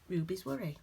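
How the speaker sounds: plain reading voice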